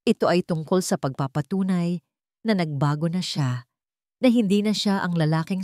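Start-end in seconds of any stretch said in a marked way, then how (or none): none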